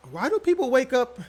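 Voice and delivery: ordinary delivery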